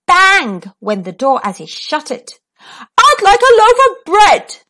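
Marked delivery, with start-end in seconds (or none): 3.0-4.6 loud, higher booming voice. Acted. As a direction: boom it across the shop. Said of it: "boomed Mister Noisy".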